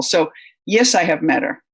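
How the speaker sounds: ordinary talk, nothing marked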